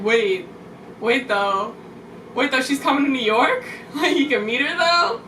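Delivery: rising disbelief, getting excited; wide-eyed retold surprise; surprised. Realizing the idol is in town.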